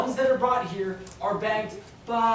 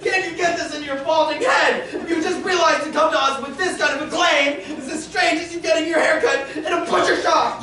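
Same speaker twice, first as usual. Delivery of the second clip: hysterical laughter; 5 semitones higher than usual. Acted. To the widow, laughing hysterically at her claim. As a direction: laugh through the insult. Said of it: "are you laughing at me".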